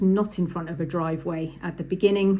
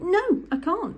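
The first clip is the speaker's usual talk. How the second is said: much higher squeaky "No, I can't"; flustered learner; 12 semitones higher, slower than usual. Retold or imitated. Learner refusing the examiner again, high-pitched and rattled. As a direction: blurt it like a panicking learner. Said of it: "My student just went".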